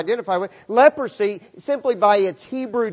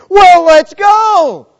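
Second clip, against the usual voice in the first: slow, loud, high call to go; rally the others, loud and drawn out; eager shout; 11 semitones higher, 13 dB louder, much slower than usual. Retold or imitated. Lepers urging each other to the priest, loud slow shout.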